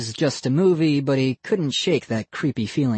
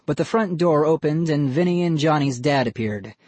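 usual speaking voice

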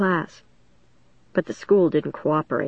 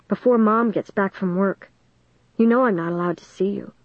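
ordinary delivery

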